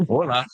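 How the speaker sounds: mock puzzled Hola, lifted in pitch; imitating Americans taking the name as Spanish Hola; Spanish mispronunciation. Quoting puzzled Americans hearing his name, mock-puzzled Hola.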